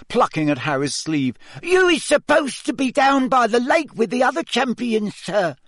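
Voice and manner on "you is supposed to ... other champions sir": very high, squeaky elf voice; squeaky house-elf. Acted. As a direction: squeak it like a tiny anxious elf. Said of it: "squeaked Dobby".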